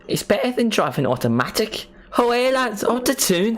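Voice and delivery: high put-on Geordie, drifting into Irish; Geordie attempt slipping Irish. Shown as a demonstration. Streamer copying the tutorial, Geordie attempt sliding into Irish. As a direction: copy Geordie in a high voice, badly. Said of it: "I keep switching back to Ireland".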